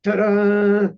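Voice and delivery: loud drawn-out ta-da; mock fanfare. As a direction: announce the big reveal with a flourish. Storyteller imitates unveiling fanfare.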